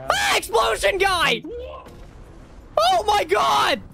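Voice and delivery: high, startled scream; panicked shout. Real, not acted. Player spotting an exploding monster, high panicked shout. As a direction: shriek a warning, pitch shooting up.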